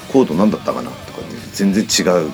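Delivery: ordinary talk, nothing marked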